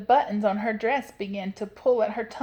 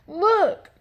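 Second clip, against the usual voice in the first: loud, high exclamation; Papa Bear's growl; 11 semitones higher, 8 dB louder than usual. A narrator's reading. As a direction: call out sharply as an upset father bear. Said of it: "growled Papa Honey Bear".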